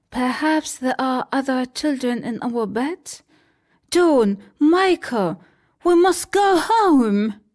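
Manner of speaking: put-on older sister rallying her brothers; urgent Wendy. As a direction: call the little ones home. Narrator voices Wendy.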